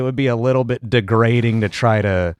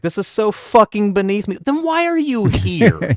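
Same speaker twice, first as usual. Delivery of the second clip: mock Orson; 8 semitones higher than usual. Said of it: "he was just like".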